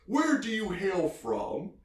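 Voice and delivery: high, slow, hammed-up questioning; imitated father. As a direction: ask it grandly as the put-on dad. Father questions the Irishman, retold.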